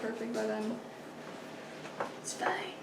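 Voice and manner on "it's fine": breathy resigned whisper. Real, not acted. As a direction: breathe it out, giving up.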